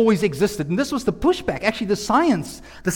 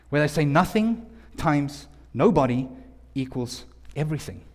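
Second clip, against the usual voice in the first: ordinary talk, nothing marked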